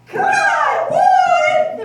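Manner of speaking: very high, very loud squeal; shocked piglets. Acted. Brothers recoil at the idea of cutting wood. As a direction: shriek it in disbelief. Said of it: "the brothers replied".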